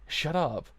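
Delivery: irritated retort. Acted. Acted retort to teasing.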